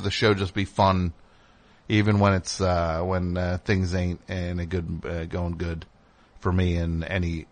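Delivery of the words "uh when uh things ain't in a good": ordinary delivery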